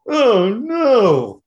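high, loud mock dread; caught dismay. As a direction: wail it like a kid caught sneaking in. Caught by host in dark.

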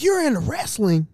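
high, loud delighted disbelief; excited. Retold or imitated. Girlfriend's dad finds out guest likes wrestling, excited. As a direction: blurt it out in high-pitched excitement. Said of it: "he got excited".

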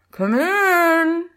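high and loud, as if behind a door; muffled. Acted. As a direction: call from behind a closed door. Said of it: "greeted with a muffled".

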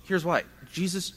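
ordinary talk, nothing marked

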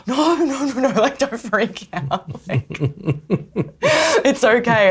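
panicked reassurance. Retold or imitated. Photographer's assistant calming a frightened model, flustered and high. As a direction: scramble to calm them down. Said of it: "And I'm like".

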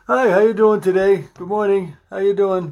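high, loud, cheery show-host hello; loud upbeat greeting. Retold or imitated. Son showing him how to greet viewers, loud cheery welcome. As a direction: greet them like you're thrilled to see them. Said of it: "how to do stuff that I'm doing".

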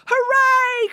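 very high, jubilant hooray; gleeful cry; crowd cheer. A narrator's reading. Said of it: "cried the crowd".

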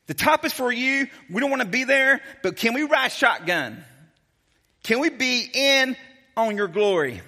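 pleading disciple. Acted. James and John begging Jesus for seats, eager and high. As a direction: wheedle for the best seats. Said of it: "they're like".

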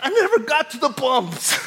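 high-pitched protest, hammed up; comic exasperation. Driver's silent retort to the policeman, comic exasperation. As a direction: whine it high as a mock-exasperated punchline. Retold exasperated thought, played for laughs.

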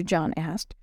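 ordinary delivery, nothing marked

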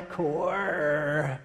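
core with a strong, drawn-out R; country R. Retold or imitated. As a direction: lean hard on the R in core, country style. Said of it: "he pronounces that R on there".

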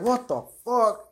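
shocked outcry. Retold or imitated. Kid reacting to being burned, retold in high drawn-out shock.

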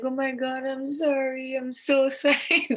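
imitating an apologetic partner, light laugh; mock flustered apology. Partner apologizing after accidental hit.

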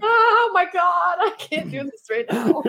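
high-pitched and slow at first, then dissolving into laughter; a bystander fighting off the giggles; high, slow, laughing disbelief; trying not to laugh. Retold or imitated. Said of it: "I'm like".

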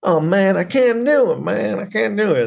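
high-pitched mock whine; Kirk Cameron. Imitating the student begging off the debate, high whine. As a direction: wail in high, hammed-up defeat. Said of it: "Kirk Cameron's like".

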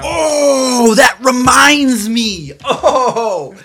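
a sudden laughing shout of recall; excited laughing outburst. Real, not acted. To her cohost, remembering a rumor, laughing excited shout. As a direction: blurt it out, laughing and loud.

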